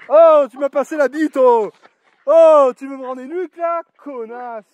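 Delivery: startled angry shout at the dog; real yelp at dog. Real, not acted. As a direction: yell at the dog that just nipped you. Dog bites him, he yells.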